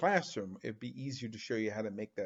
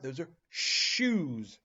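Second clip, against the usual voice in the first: shoes said slowly with a long sh; slow, clear naming; 3 dB louder, slower than usual. Shown as a demonstration. Teacher naming the picture slowly for young learners. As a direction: stretch out the sh in shoes. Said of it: "shoes begin with S H".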